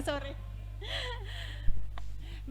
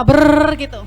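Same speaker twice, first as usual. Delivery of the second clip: lip vibration; 12 dB louder, slower than usual. Shown as a demonstration. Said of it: "coba ngomong bersama-sama".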